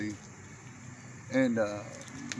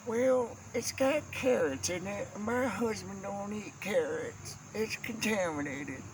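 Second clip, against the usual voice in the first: put-on higher, prissy old-lady voice; fussy aunt; 5 semitones higher, 3 dB quieter than usual. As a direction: mimic the aunt's dainty complaint. Aunt's quote retold by uncle.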